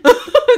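high, loud burst of laughter; sudden loud cackling. Real, not acted. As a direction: crack up at your own joke. Laughs at own outburst.

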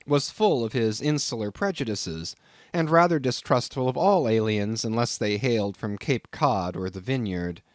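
ordinary talk, nothing marked

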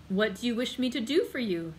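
usual speaking voice

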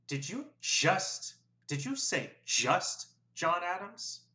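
high, very slow incredulous question; slow disbelief. A narrator's reading. Registrar slowly questions Will's answer. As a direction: stretch every word out, stunned. Sam narrows eyes, disbelieving.

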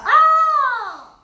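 sudden high shriek; startled scream. Real, not acted. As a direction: shriek in surprise. Startled in game.

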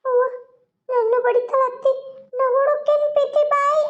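put-on high child voice calling grandma; little girl voice. Little girl telling grandma that auntie hits her. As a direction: tell on auntie like a small child.

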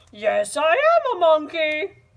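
high, loud silly monkey; goofy ape voice, pushed. Acted. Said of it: "in my monkey voice".

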